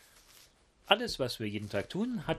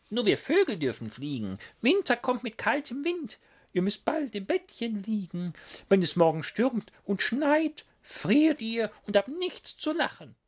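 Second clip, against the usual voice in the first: high, scolding owl voice; 13 semitones higher, 4 dB louder than usual. Acted. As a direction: scold them like a fussy old owl bird. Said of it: "Eine Eule warnt und schimpft". (hohe, schimpfende Eulenstimme)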